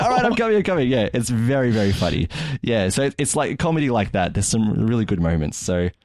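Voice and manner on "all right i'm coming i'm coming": much higher, exasperated giving in; daughter giving in. Retold or imitated. Daughter gives in to dad's megaphone, high and exasperated. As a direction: cave in, high and fed up. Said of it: "and so he's like".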